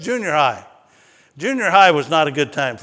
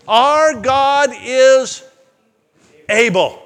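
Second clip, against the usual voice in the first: loud, high, drawn-out declaration of faith; bold proclamation; 8 semitones higher, 6 dB louder, much slower than usual. Acted. Three men answering the king, bold proclamation. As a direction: proclaim it slowly, pausing before the last word.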